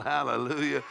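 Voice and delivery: ordinary talk, nothing marked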